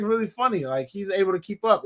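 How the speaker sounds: ordinary delivery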